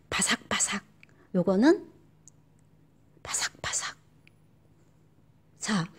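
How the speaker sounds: whispered throughout; hushed ASMR-like talk. Shown as a demonstration. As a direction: whisper as if tasting crunch.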